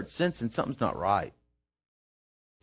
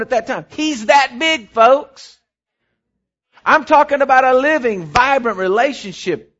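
fervent preaching; 11 semitones higher, 8 dB louder than usual. Acted. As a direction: preach it out to the congregation. Preaching at full force.

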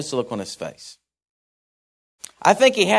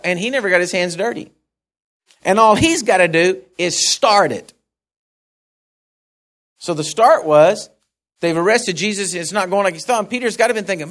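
ordinary talk

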